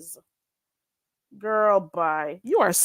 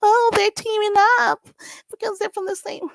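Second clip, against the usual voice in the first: high whiny mock of the gossip; mocking them; 13 semitones higher than usual. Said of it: "Talking about".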